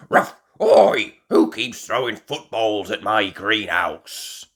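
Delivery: loud grumbling call over a fence; calling grumpy dog. A narrator's reading. Grandad Dog calls over the fence about footballs, loud and cross. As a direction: bark it out, annoyed. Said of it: "Grandad Dog calls over the fence".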